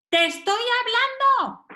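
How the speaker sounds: high, insistent demand for attention; harsh reprimand. Acted. Parent demands a child's attention, harsh. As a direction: act out an exasperated telling-off. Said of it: "Es fuerte".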